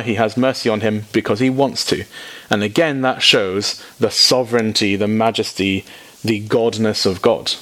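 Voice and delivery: normal talking voice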